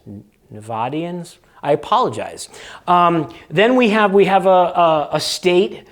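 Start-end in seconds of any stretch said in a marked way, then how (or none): 0.0-1.4 Nevadians said with a flat a as in cat, nuh-VAD-ee-ans; local Nevada pronunciation. Shown as a demonstration.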